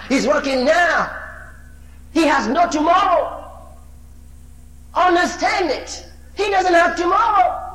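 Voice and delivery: rising preacher's cry; full-force preaching. Acted. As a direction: proclaim it at full power. Preaching builds to a shout.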